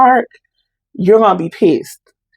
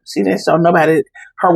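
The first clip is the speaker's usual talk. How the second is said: ordinary talk, nothing marked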